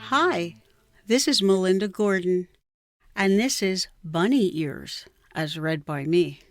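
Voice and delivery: ordinary delivery, nothing marked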